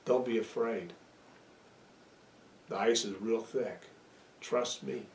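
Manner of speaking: calm a frightened listener; softly voiced Lord comforting him on the ice; reassuring God. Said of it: "the Lord is telling me".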